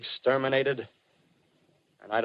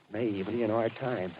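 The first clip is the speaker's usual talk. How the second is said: ordinary delivery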